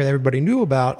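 ordinary talk

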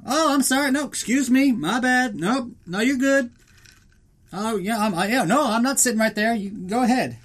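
high-pitched, meek mock apologies; overly polite apologizing. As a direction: back down politely, voice pitched right up. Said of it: "gets pretty polite".

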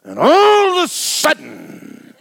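high, loud preaching outburst; preaching shout. Retold or imitated. Preacher reliving his pulpit outbursts, high loud shout. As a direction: burst into full-force preaching, high and loud. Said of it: "start preaching".

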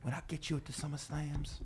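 intense soft whispered yell. Retold or imitated. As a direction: hiss it like a wrestling promo. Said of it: "He's a big whisper yeller".

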